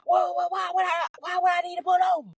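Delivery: high-pitched alarmed protest; mama yelling. Retold or imitated. Mama on the phone told to stop the car, high alarmed yelling. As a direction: imitate a panicked mother, voice shooting up high. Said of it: "she started yelling some more".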